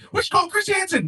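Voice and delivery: scandalized and disgusted; wife catching husband watching video; shocked wife. Acted.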